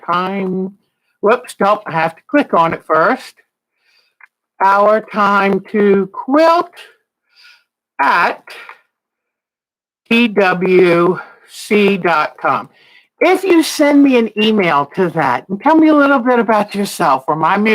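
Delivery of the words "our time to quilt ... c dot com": slow dictation. Shown as a demonstration. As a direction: spell out "Our time to quilt at T W C dot com" very slowly, letter by letter. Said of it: "dictating the email address".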